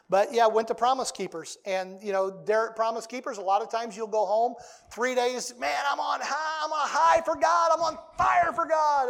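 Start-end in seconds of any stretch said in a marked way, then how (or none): 5.6-9.1 mock the guys yelling they're on fire; imitating a man buzzing after a rally, shouting high; fired-up shout. Guys fired up after Promise Keepers.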